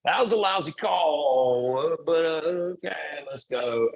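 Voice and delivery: a lightly voiced coach shrugging off a bad call; mock complaint to the ref. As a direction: grumble at the ref, then let it go. Acting out what to tell the referee.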